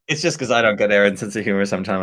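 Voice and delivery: normal talking voice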